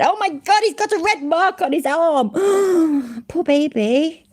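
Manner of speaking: very high, hammed-up pity with a gasp; mock horror. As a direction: coo with exaggerated fake concern. Sarcastic mock concern for complainer.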